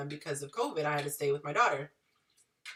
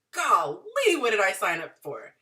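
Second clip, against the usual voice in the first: much higher and louder, alarmed Golly; shocked exclamation; 3 semitones higher, 6 dB louder than usual. Retold or imitated. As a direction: gasp it out in disbelief. Shocked by chair thrown at office.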